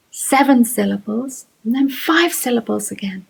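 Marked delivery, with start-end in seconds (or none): none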